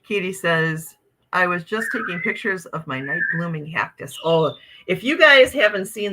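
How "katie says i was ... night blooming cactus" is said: ordinary talk, nothing marked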